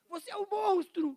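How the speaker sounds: a shocked, loud "Você é um monstro" with a falling "monstro"; accusing intonation. Shown as a demonstration. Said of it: "dependendo da entonação".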